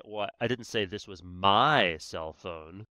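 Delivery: emphasis on the word my; stress on MY. Shown as a demonstration. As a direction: punch "my" with a rise. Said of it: "then I could say".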